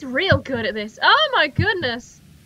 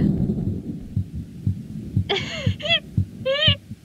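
high, squeaky giggling fit; long laugh in short high bursts; laughter; 10 semitones higher than usual. Real, not acted. Player laughs at the creature.

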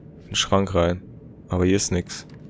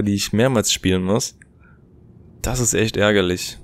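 usual speaking voice

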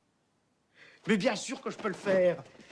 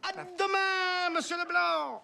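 hammed-up producer; 9 semitones higher, 4 dB louder, slower than usual. Drawn-out goodbye in role.

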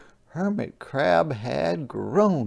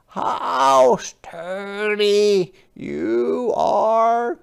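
high, slow, gentle crab voice; a small polite sea creature asking; Hermit Crab voice; 9 semitones higher, 7 dB louder, much slower than usual. Acted. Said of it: "said Hermit Crab".

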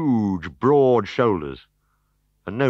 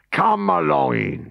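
hearty, slow welcome; jovial host greeting; 8 semitones higher, 4 dB louder, slower than usual. Acted. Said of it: "he said jovially".

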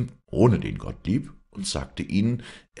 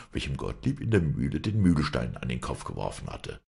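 ordinary delivery

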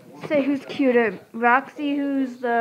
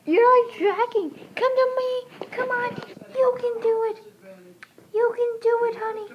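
coaxing pet talk; 11 semitones higher than usual. Real, not acted. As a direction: sweet-talk the puppy over. Coaxing her dog to come.

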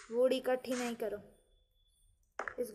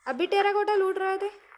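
high, louder startled question; surprised question; 7 semitones higher, 6 dB louder than usual. Real, not acted. To teammate who switched tasks, a surprised high question. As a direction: catch him out, surprised.

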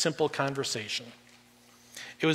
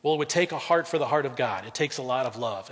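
ordinary delivery

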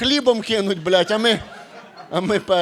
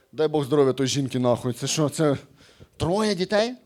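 ordinary talk, nothing marked

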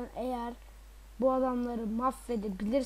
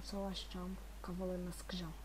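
whispered threat; 5 semitones lower, 12 dB quieter than usual. Real, not acted. Vowing revenge on enemies in game.

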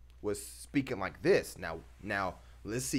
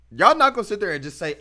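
louder, fast and higher, protesting the viewers; worked up; 8 semitones higher, 11 dB louder, faster than usual. Real, not acted. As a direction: fire it off fast, pushing back.

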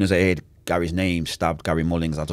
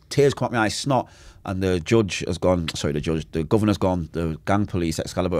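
ordinary talk

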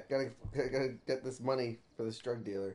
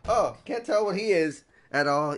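mocking Samuel; 7 semitones higher, 12 dB louder than usual. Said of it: "Samuel's like".

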